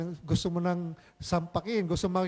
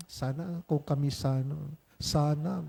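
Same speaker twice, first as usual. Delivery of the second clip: wistful longing; 5 semitones lower, 6 dB quieter than usual. Retold or imitated. Mocking a lovelorn wish.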